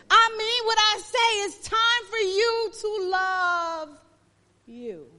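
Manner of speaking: loud, high, driving sermon cry; preacher pushing the congregation hard, loud and high; preaching at full force. Acted.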